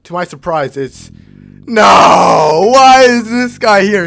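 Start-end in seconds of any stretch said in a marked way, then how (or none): 1.6-4.1 loud, high outraged yell; shocked yelling. Real, not acted. Player yelling at the screen as the villain appears, loud shock. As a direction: yell in real disbelief. Sees rival sabotaging the cello.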